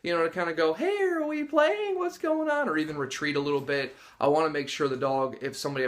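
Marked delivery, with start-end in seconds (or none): 0.7-2.7 very high, drawn-out curious questions; playful dog voice. Retold or imitated. Dog being threatened asks to play, high playful voice. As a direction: voice a dog hoping for a game.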